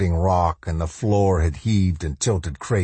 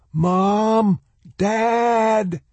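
high drawn-out call; shouting across. A narrator's reading. Boy calling parents across the chasm, shouting. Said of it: "he shouted to the other side".

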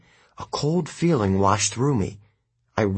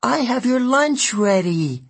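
much higher, louder thin mother's voice; pleading mother calling; 14 semitones higher, 4 dB louder than usual. A narrator's reading. May calling after her son as he leaves, high pleading. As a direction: call after him, pleading. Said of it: "May Castellan called after him".

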